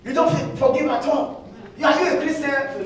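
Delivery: wife scolding husband who wants quick forgiveness; mock angry wife. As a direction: nag him like an unforgiving wife. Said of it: "your wife is still saying".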